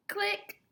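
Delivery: click. Pretend. Camera click.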